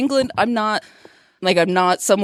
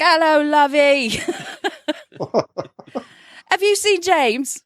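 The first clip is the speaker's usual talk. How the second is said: lightly imitated London greeting; London accent; 5 semitones higher than usual. Husband's friend's mum calling, a hint of London accent. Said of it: "she was from London".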